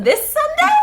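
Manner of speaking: hammed-up high panic; mock shock. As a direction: squeal it out in a panic.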